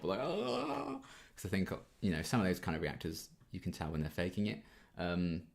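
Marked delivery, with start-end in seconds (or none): 0.2-1.0 long high-pitched "ah", sliding upward; over-the-top mock scream. YouTuber mimicking over-the-top reactors, mock high scream. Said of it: "like, quite over the top".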